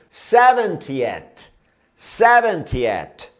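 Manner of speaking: seventieth stretched out very slowly, syllable by syllable; teacher drags seventieth out for learners; slow model pronunciation. Shown as a demonstration. Teacher drilling students, very slow model word. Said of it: "Septagésimo".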